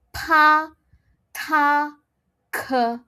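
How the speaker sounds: Pa, ta, ke with a strong puff of breath; aspirated p t k. Shown as a demonstration. Teacher demonstrating second row, blowing air. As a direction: blow air on Pa, ta, ke. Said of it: "we will have to blow".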